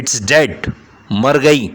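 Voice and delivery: loud curt reply; annoyed man brushes off the question. Acted. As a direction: bite the answer off. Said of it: "फिर से गुस्से में".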